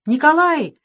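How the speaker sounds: loud call of a name, high; shout. A narrator's reading. Boy shouts to wounded Nikolai, loud call. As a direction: call out to him from afar. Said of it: "закричал Саша".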